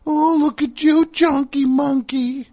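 very high, slow mock coo; squeaky, sing-song baby-talk for a pet; fun dog voice. Owner mimicking the groomer greeting his dog, squeaky fun dog voice. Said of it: "that fun dog voice".

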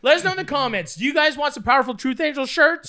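high, hyped mock influencer pitch; content creator. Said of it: "You talk to a camera".

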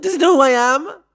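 high, loud mock offense; how dare he, diva-style; diva outrage. Real, not acted.

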